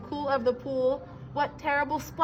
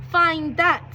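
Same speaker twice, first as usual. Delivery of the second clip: sneered; 4 semitones higher, 5 dB louder than usual. A narrator's reading. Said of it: "sneered the bird".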